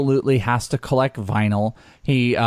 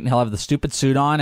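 ordinary talk

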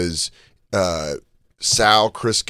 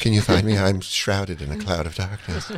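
mock gloomy fan; 3 semitones lower than usual. Sad fan hiding from Chris, mock gloomy.

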